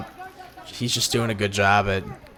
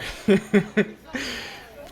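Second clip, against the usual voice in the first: real high staccato chuckle; laugh; 12 semitones higher than usual. Real, not acted. Vlogger recalling not wanting to get tapped out, laughing. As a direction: let out a run of quick, high chuckles. Laughs after retelling near defeat.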